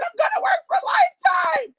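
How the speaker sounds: telling husband the big news, screaming; ecstatic shout. Retold or imitated. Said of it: "I said".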